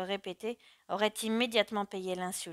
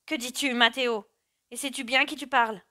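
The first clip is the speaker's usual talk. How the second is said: louder, raised offended questions; indignant wife; 4 semitones higher, 7 dB louder than usual. A narrator's reading. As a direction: challenge your husband, affronted. Wife outraged at husband's accusation. (épouse indignée qui proteste)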